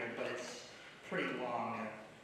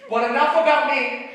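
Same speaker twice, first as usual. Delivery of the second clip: loud, high, laughing through the joke; laughing punchline; 9 semitones higher, 10 dB louder than usual. Real, not acted. Friend delivering his eulogy punchline, laughing. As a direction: land the gag and crack up.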